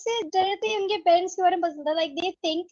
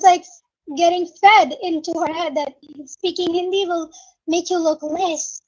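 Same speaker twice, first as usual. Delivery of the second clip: ordinary talk, nothing marked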